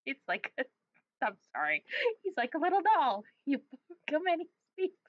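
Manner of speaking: giggles breaking up the words; laughing while reading. Real, not acted. Reading a silly idea to a friend, giggling through it. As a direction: try to read through giggles.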